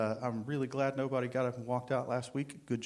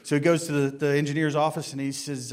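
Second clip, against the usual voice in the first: normal talking voice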